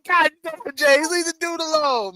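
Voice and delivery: high-pitched exasperated pleading; hammed-up high protest; retold plea.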